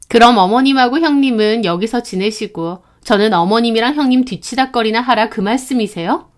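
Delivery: ordinary delivery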